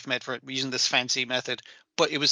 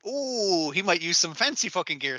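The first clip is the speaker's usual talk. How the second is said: mocking awe; 3 semitones higher than usual. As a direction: act impressed by fancy airs. Mocks a fancy taxidermist.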